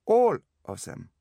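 put-on loud, slow, high German accent; blunt German-accented answer; emphatic German doctor. A narrator's reading. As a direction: hit "all" hard in a German accent.

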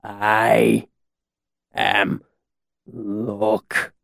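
one drawn-out word at a time; halting, befuddled voice; slow, dazed speech. A narrator's reading. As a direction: speak as if lost, with long gaps. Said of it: "he said slowly".

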